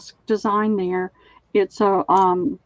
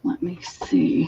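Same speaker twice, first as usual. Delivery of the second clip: whispered to herself; 5 semitones lower than usual. Real, not acted. Muttering while searching for items.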